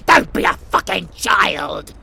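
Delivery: loud contemptuous scold; angry scolding shout. Acted. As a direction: snap at them with contempt. Villain scolds listener.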